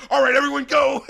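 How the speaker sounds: high, loud rallying call; imitated excited kickoff; hyped up. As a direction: urge the whole room on. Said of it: "Everyone's hyped up".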